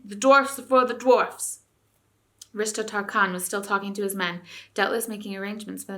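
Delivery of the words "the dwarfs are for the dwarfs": loud, slow defiant dwarf motto; a dwarf taunts the boy with the motto; jeering dwarf. A narrator's reading. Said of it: "the dwarfs jeered back at Eustace".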